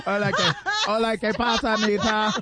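lightly laughing fake Spanish accent; mock Spanish accent. Patty hamming a Spanish accent for the crew. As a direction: joke through a Spanish greeting. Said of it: "Why you saying it like you're from Osaka".

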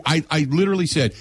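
ordinary talk, nothing marked

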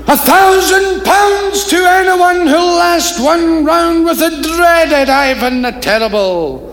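high, slow, booming announcer; loud ringmaster voice; circus barker. Acted. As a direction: call out to the crowd like a ringmaster.

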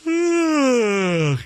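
high groaning mock sigh; big weary sigh. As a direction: heave out an exaggerated sigh. Sighing about being tired.

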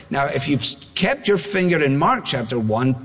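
usual speaking voice

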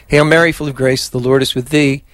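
steady devout Hail Mary; prayer recitation. Acted. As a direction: lead the prayer evenly. Leads the rosary.

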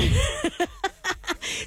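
high giggly laugh. Real, not acted. Streamer fearing her joke gets her cancelled, giggling. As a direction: burst into a real high laugh. Jokes she'll get cancelled.

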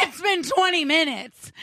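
laughing retort. Retold or imitated. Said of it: "I said".

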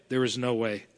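hushed repeat. Acted. Prayer repeated, dropping away.

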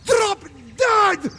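high yell; shouted retort. Acted. Trucker yelling after road hog, shouting drop dead. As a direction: throw it after him.

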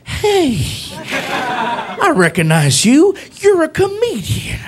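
high, slow excited cop voice; imitating the cop. Police officer recognizing comedian, high and slow. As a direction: play the cop, thrilled and drawn out. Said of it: "He's like".

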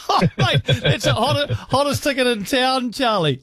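high laughing delight. Real, not acted. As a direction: laugh through the words. Host laughing with caller.

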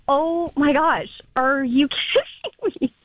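slow, drawn-out incredulity with a laugh; retold fuming disbelief. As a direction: stretch it out, half laughing in disbelief. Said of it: "'Cause now I'm fuming".

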